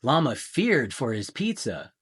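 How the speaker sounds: plain reading voice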